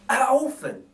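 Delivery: mildly pitying thief; a touch of pity. Acted. Narrator voices Bill pitying the baby, lightly. Bill's voice in read story.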